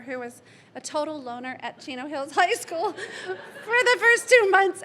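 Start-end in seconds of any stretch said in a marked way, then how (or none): 2.3-4.8 a choked laugh that breaks into tears, louder; tearful laugh breaking loud; laughing through tears. Real, not acted. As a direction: laugh and cry at once. Emotional memorial, laughing through tears.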